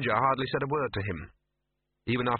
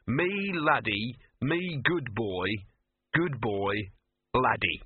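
slow, simple dog talk; simple-minded dog; slower than usual. Acted. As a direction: spell it out slowly like a dim, eager dog. Dog translates a simple dog's barks.

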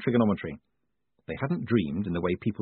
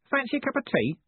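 lightly voiced odd character, pitched high; airy, offhand offer in a raised voice; high-pitched rooftop character. A narrator's reading. Pigeon fancier offers tea.

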